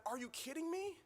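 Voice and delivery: incredulous dad thinking it; disbelief. Retold or imitated. Father's thought, disbelief.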